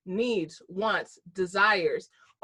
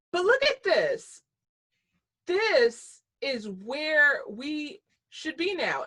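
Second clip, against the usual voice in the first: excited; 7 semitones higher, 4 dB louder, much slower than usual. Real, not acted. Excited reveal of slide.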